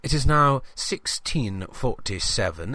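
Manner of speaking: usual speaking voice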